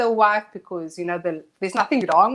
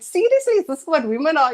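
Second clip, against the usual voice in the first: shocked disbelief; 7 semitones higher, 5 dB louder than usual. Retold or imitated. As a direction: pitch jumps way up in astonished disbelief. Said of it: "I said".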